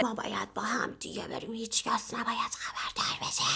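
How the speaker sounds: mock whisper. Mocking the couple's secret plan in a soft mock whisper. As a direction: breathe it like a silly conspiracy.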